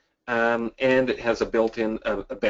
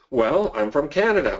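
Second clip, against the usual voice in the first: usual speaking voice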